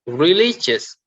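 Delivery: "religious" with LI high and loud; stress on middle syllable. Shown as a demonstration. Teacher reading out the middle column, middle stress on "religious". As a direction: punch LI in "religious". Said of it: "stress on the middle".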